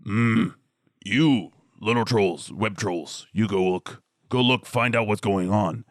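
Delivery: slow, halting troll voice; grumpy big troll. Acted. Big troll ordering the smaller creatures to look around, slow grumpy troll voice. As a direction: grunt out orders like a dim brute. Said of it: "And it says".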